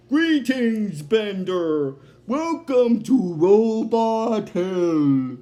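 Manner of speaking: grand, loud, drawn-out villain welcome; Robot Devil. Retold or imitated. Devil greeting a new arrival, theatrical drawn-out welcome. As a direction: boom it out very slowly, savouring each word. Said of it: "then the robot devil's like".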